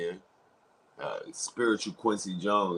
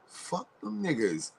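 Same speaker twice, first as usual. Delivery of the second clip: odd affected delivery; 7 semitones higher, 4 dB louder than usual. Acted. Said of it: "why are you talking like that?".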